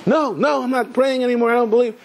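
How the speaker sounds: high-pitched, insistent mock protest; imitated indignant refusal, pitched well up. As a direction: act out a man refusing in agitation. Retold man refusing the invitation.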